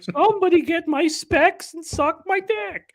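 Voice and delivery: put-on high, slow old man voice. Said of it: "I sound like an old man".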